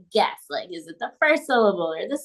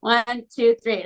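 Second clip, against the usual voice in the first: ordinary delivery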